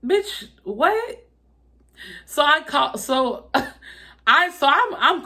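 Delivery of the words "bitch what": high, stunned bitch what; incredulous. Retold or imitated. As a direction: throw it back in disbelief. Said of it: "And I was just like".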